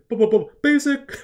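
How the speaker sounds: high, loud stuttered build into basic; a loud goofy rapper impression; imitating the rapper. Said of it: "the way he says basic is so good".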